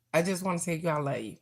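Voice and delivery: ordinary talk, nothing marked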